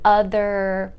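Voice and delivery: ordinary delivery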